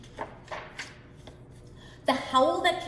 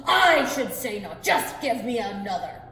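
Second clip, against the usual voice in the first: loud boastful giant; 7 dB louder than usual. Acted. Giant bluffing.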